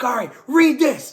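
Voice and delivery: loud, high, urgent command; imitated forceful yell; shouted order. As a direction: order it over the din. Imitates man commanding during deliverance.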